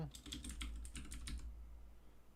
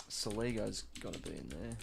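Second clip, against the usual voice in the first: ordinary delivery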